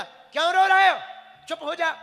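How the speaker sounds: high, loud angry demand; loud scolding. Retold or imitated. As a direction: bark at the crying kids. Grandfather scolding crying kids.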